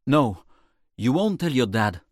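loud, forceful contradiction; firm retort. Acted. Narrator answering Reese's threat, loud firm retort.

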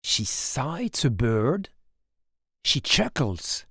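ordinary delivery

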